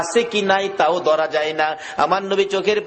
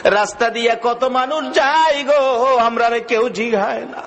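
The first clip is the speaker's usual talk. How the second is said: mildly plaintive sing-song; retold tearful plea; 7 semitones higher than usual. Bound children complain to the Prophet, mildly tearful retold plea. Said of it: "বাচ্চাগুলো কান্দে".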